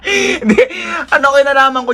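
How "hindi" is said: high laughing no; denying while laughing, pitched up; laughing denial. Real, not acted. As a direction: protest through laughter.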